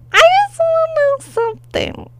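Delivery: squeaky high demand; high-pitched. Real, not acted. High falsetto demand repeated.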